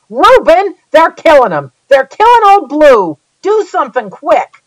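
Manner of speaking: high, loud desperate yelling; frantic yell. Acted. Rainey yelling at Reuben to save his dying hound. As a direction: shout for help in rising panic. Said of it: "Rainey yelled".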